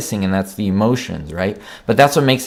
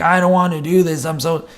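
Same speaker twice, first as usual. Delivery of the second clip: higher, whimpering little complaint; mock whiny Batman; 6 semitones higher than usual. Reviewer mocking a whining Batman, mock whine. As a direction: play Batman as a moping whiner. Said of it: "if you see Batman whining".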